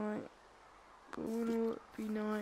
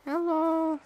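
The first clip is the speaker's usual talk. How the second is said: playful calling out; 5 semitones higher, 5 dB louder than usual. Pretend. Calling to Mister Squid.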